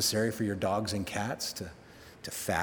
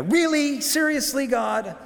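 exasperated disbelief; 13 semitones higher, 6 dB louder than usual. Retold or imitated. Jonah complaining to God.

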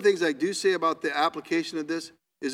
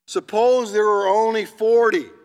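raised, louder plea to God; pressing plea; 6 semitones higher, 7 dB louder than usual. Acted. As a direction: push the bargain further, urgent and humble. Abraham presses his request.

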